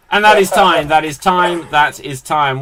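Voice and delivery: loud host's time call. Real, not acted. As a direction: call a halt over the players, loudly. Host calls time in game.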